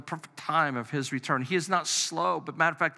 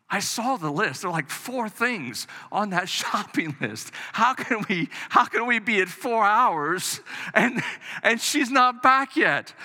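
exasperated complaint; 5 semitones higher than usual. Retold or imitated. Husband venting about his wife's long shopping trip, exasperated and building. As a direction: retell the fed-up husband, building to disbelief. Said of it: "I'm like".

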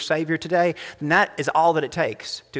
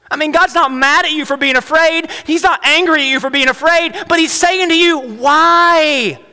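high, loud, rising sermon peak; full-force preaching; 10 semitones higher, 6 dB louder than usual. Acted. Pastor pressing the church on fear, full-force preaching. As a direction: preach it out to the congregation.

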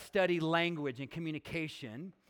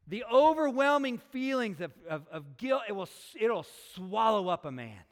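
loud, raised preaching voice; impassioned preaching; 3 semitones higher, 5 dB louder than usual. Real, not acted. Preacher to congregation on Peter's shame and guilt, impassioned preaching. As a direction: drive the point home with mounting force. Preacher presses the point with force.

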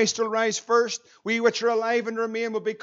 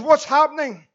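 alarmed shout; 5 semitones higher, 8 dB louder than usual. Retold or imitated. Captain bursts in, alarmed.

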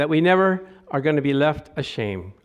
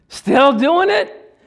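amazed rhetorical question; 12 semitones higher, 8 dB louder, much slower than usual. Real, not acted. Rhetorical astonishment.

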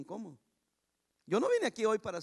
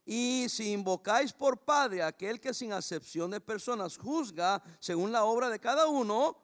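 scripture read slowly and a bit louder; 5 dB louder, slower than usual. Shown as a demonstration. Pastor reading the verse slowly to the congregation. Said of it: "Se lo voy a leer despacito".

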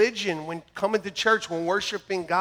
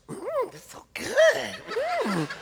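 Alicia savoring; much slower than usual. Retold or imitated. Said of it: "Alicia's like".

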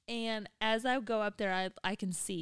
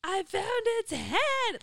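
high, loud, slow, wailing imitation of him; imitating distraught husband; 11 semitones higher, 6 dB louder, slower than usual. Wife mocks husband freaking out, high wailing voice. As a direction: play up his panic over the kitten. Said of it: "and he loses his mind".

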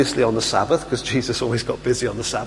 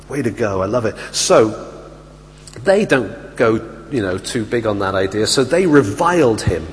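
usual speaking voice

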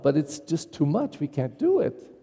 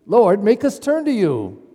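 higher, louder, insistent plea to God; urgent prayer, pitched up and pushed out; desperate plea; 4 semitones higher, 4 dB louder than usual. Shown as a demonstration. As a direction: implore the Lord in a raised voice. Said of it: "it's almost a desperate plea".

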